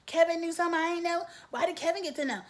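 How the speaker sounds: put-on high, fast, childish whine; whiny tantrum. Mocking Charity's tantrum at her siblings as a whiny whine. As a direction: throw a four-year-old's hissy fit. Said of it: "hissy fit like a four-year-old".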